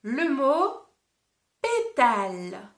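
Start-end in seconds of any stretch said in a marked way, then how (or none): 1.6-2.7 pétale said slowly, syllable by syllable, pé-tale. Shown as a demonstration. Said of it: "répète le mot après moi".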